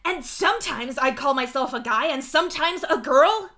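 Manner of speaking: high, loud, worked-up declaration; heated rant. A narrator's reading. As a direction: keep ranting at full volume. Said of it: "Mituna goes on".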